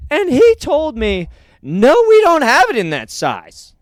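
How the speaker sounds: high, loud, slow punchline delivery; mock comedian bit. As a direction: hammer the punchline like a bombing comic. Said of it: "And he goes".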